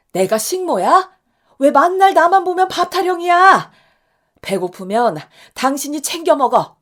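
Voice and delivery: 훨씬 높고 크게 터뜨리는 고함; 참았던 열불을 한꺼번에 터뜨리듯; 빽 지르는 고함. Acted. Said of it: "저도 소리를 빽 질러버렸죠". (남편에게 처음 대드는 아내의 높고 큰 고함)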